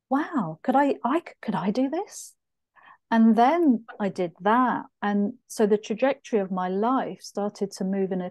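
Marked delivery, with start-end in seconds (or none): 0.1-2.3 higher-pitched retold wonder; wondering self-question. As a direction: re-enact a hopeful question to yourself, pitch lifted. Retells her wondering thought.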